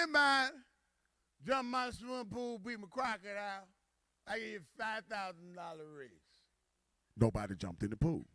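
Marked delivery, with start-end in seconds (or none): 0.0-6.1 acted tipsy company president; drunk boss. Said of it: "He got drunk, started making deals".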